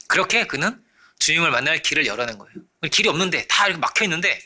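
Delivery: normal talking voice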